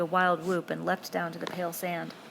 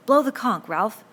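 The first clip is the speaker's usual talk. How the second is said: louder, higher urging; urgent plea; 4 semitones higher, 10 dB louder than usual. A narrator's reading. Piggy urges Ralph loudly to blow the conch. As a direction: push him to act now.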